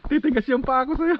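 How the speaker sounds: laughing tease. Real, not acted. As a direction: laugh through the words. Repeats it laughing, much higher. (pabirong tawa)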